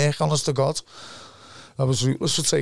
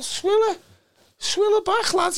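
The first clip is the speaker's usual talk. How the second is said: urging shout. Retold or imitated. A mate yelling at his cousin in the pub to throw a pint, high shout. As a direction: egg him on, shouting high. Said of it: "I said to the Paul".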